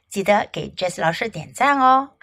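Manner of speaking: plain reading voice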